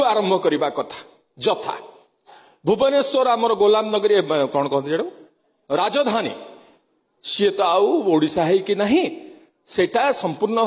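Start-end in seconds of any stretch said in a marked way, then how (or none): none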